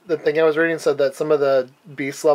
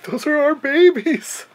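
high, slow horrified cry; 12 semitones higher, 3 dB louder, slower than usual. Retold or imitated. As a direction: gasp it out in horror. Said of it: "like, horrified".

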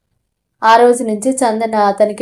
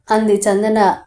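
ordinary delivery

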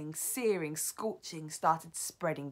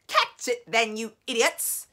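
loud, higher angry screech at her witches; furious scream; 5 semitones higher, 11 dB louder than usual. Acted. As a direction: scream the order down from the platform. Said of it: "screamed the Grand High Witch".

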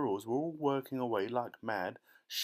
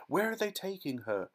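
high, frantic shriek; 8 semitones higher than usual. A narrator's reading. Mother shrieking as her daughter is carried off. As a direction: cry out in terror. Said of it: "shrieked Missus Salt".

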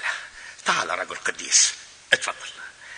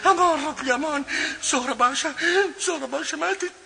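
anguished wailing. Acted. Father wails she is dead.

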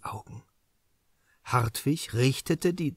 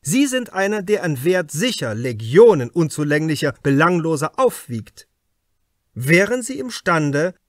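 a raised, higher, pressing voice, louder; probing inspector; 5 semitones higher, 5 dB louder than usual. Acted. Inspector Krause questions the suspect writer Hartwig, louder and pressing. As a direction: lean in hard, like an interrogator closing in.

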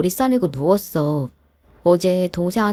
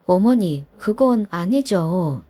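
ordinary talk

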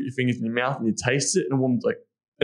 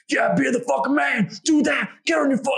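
mocking the macho boy savage, much higher and louder; mock boy savage yell; 13 semitones higher, 3 dB louder than usual. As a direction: ham up a brash boy yelling orders. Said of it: "So the boy is".

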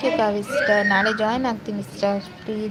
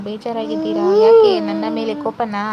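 rising higher and louder into a teasing cry; playful loud pleading; 6 semitones higher, 10 dB louder than usual. Real, not acted. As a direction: push it up, mock-hurt and loud. Teasing silent viewer on live.

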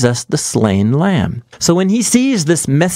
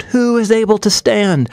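mild crying out; 7 semitones higher than usual. A narrator's reading. People of the earth before God's judgment, mildly crying out. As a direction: cry out the question, pitched up without shouting. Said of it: "the people of the earth cry out".

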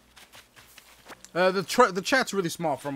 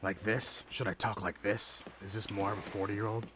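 lower, deeper put-on grown-up voice; Batman-y forty-year-old voice; 7 semitones lower, 9 dB quieter than usual. Shown as a demonstration. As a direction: drop into a gruff Batman growl. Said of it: "more Batman-y?".

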